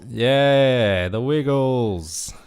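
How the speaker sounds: long drawn-out, loud but weary cheer; underwhelmed cheer. Real, not acted. Guest answers host's big Wiggles reveal, underwhelmed drawn-out cheer. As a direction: drag out a half-hearted hurrah. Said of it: "please don't sound so excited".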